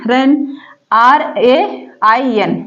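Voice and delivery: slow letter-by-letter spelling of रैन; spelling out slowly. Shown as a demonstration. Teacher spelling रैन for students, slowly. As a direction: spell each letter apart. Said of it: "spelling out letters".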